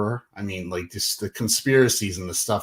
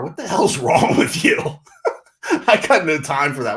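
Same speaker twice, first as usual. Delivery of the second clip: laughing; 6 semitones higher, 7 dB louder than usual. Real, not acted. Laughing at tough guys.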